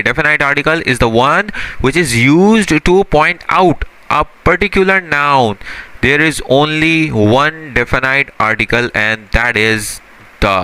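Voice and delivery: very slow English definition, word by word; slow dictation. Shown as a demonstration. Teacher dictating the definition of definite article slowly. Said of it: "definite articles होते क्या हैं?".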